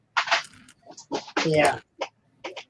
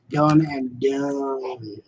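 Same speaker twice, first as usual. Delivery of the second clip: very slow, softer sign-off; drawn-out finish; 2 semitones lower, 5 dB quieter, much slower than usual. Real, not acted. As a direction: stretch each word out with finality. Signs off with drawn-out flourish.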